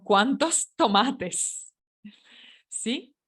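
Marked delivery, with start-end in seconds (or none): 0.0-1.7 Cuántos tomates slow, laughing on the way; slow correct plural. Shown as a demonstration. Teacher showing students the correct plural. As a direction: drag out Cuántos tomates with a chuckle. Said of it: "No, digo".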